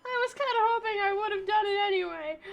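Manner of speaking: high, loud wailing whine; half-laughing fake sob; mock crying. Real, not acted. Gamer whines after losing the round, high mock-crying wail.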